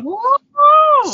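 high, loud whoop; excited cheer. Real, not acted. Cheering the big India audience.

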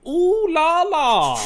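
high, slow, loud camp flourish; hammy Ooh la la. Pretend. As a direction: sing it out theatrically. Hamming after Patrick Stewart joke.

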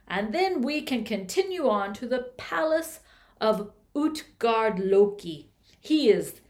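loud giant speaking kindly; booming frost giant. Acted. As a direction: boom it out friendly and big. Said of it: "and said".

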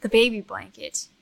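firm demand. Acted. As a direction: order them to hand it over. NPC demands blanket back.

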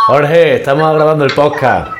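announcing loudly down the line; calling out. Real, not acted. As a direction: project to someone on speakerphone. Calling out to brother by phone.

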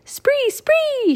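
very high, drawn-out raven cry; bird call. A narrator's reading. Annie calling gleefully while flying, bird call. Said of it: "she called".

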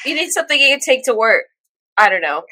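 ordinary delivery

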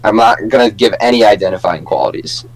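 ordinary talk, nothing marked

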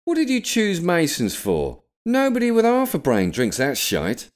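loud, high-pitched angry outburst; angry pub regular. A narrator's reading. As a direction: berate someone across the bar, loud and high. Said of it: "arms outstretched in anger".

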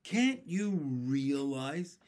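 slow, deliberate, stretched-out explaining; firm, measured appeal to someone not getting it; patient. A narrator's reading. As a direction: spell it out with laboured patience. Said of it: "he said patiently".

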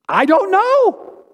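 very high, slow, mock-helpless "I don't know"; squeaky comic "I don't know". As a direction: squeak it out like a baffled shrug. Comic refrain answering hard questions.

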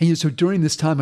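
usual speaking voice

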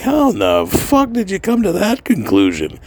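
incredulous outburst. Retold or imitated. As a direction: ask it as if the idea is absurd. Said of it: "I'm like".

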